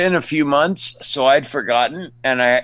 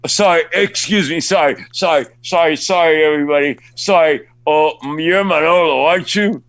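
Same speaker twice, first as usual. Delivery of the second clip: higher, insistent string of apologies; intrusive fan; 5 semitones higher, 4 dB louder than usual. Retold or imitated. Fan interrupting the actor's restaurant dinner, higher eager apologizing. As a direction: push in excitedly, imitating a star-struck fan.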